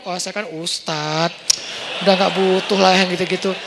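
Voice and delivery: lofty, stretched-out self-regard; mock self-important. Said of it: "nggak boleh terlalu sok kegeeran".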